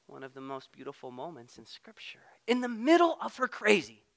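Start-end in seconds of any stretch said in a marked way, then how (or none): 0.1-2.2 breathy whisper, full of reverence; whispered awe. Real, not acted. Preacher hushing the room for the resurrection moment, whispered. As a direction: lean in and whisper it like a secret.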